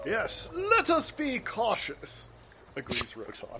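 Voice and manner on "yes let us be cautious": high, slow, booming declaration; loud proclamation. Acted. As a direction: proclaim it to the hills, drawn out. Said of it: "Proclaims it to the hills".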